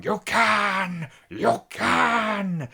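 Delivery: over-the-top mock O'Toole exhortation; imitating Peter O'Toole. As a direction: bellow it like a theatrical old actor. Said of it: "he keeps going".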